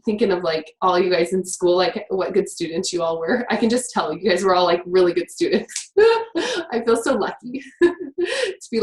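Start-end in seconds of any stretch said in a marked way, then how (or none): 6.0-8.8 laughing. Real, not acted. Laughs while praising students.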